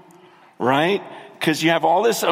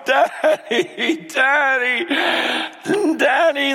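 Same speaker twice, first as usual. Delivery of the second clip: high, tearful cry of Daddy, breaking; calling out to a father through tears; retold sobbing cry; 10 semitones higher, slower than usual. Preacher recalling weeping in study, high sobbing cry. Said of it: "I literally just start sobbing".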